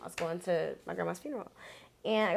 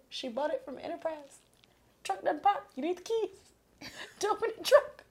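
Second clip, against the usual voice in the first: high, rushed, desperate explaining; retold panic with laughter breaking in; frantic pleading; 7 semitones higher, 4 dB louder than usual. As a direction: talk fast and high like you're begging not to be arrested. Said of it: "I'm crying".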